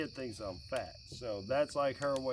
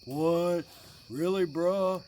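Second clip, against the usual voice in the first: mock teen protest; 5 semitones higher, 5 dB louder, slower than usual. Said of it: "You say".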